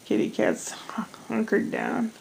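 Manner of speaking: soft, slow whisper; whispering about the cat. Real, not acted. Watching the kitty curl up, a slow hushed whisper. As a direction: hush it so the cat stays asleep.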